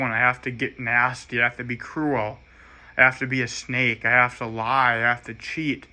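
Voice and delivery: usual speaking voice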